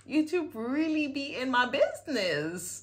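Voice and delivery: high, loud exclamation; played-up astonished outburst; mock-outraged amazement. Acted amazed reaction to video.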